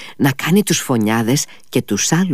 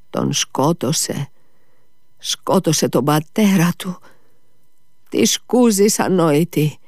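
weak voice; 9 semitones higher, slower than usual. A narrator's reading. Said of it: "με αδύνατη φωνή".

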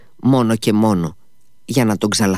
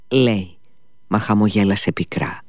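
usual speaking voice

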